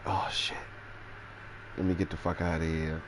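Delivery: unvoiced whisper of alarm. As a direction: whisper it under your breath. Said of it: "So I'm like".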